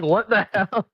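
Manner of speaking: high-pitched mock disbelief; retold shock. Dad learns who the visitor was, retold shock. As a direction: blurt it as a stunned dad. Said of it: "my dad damn near crapped his pants".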